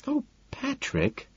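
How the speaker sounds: high, soft, slow sigh; airy high-pitched exasperation. A narrator's reading. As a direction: sigh the name in reproach. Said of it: "she sighs".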